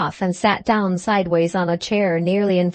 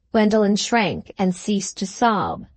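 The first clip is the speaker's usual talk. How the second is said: usual speaking voice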